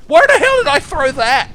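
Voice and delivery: high, very loud outburst; exasperated yell. Real, not acted. Player after a bad throw, exasperated yell. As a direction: yell in disbelief at your own throw.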